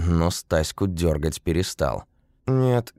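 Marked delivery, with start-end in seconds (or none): none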